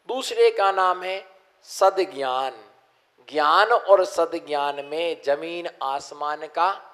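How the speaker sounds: normal talking voice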